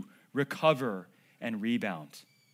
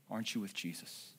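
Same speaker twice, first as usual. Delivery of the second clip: soft question; 7 semitones lower, 13 dB quieter than usual. Acted. Servant girl's question retold softly.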